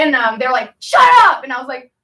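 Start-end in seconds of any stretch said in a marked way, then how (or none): 0.8-1.4 high loud bark of shut up; sharp yelled order; guard yelling. Retold or imitated. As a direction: snap at the prisoner. Guards yelling back at her.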